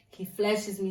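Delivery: ordinary delivery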